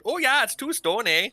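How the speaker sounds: high, hammed-up Canadian accent; mock Canadian "eh". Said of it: "you did a Canadian accent for British".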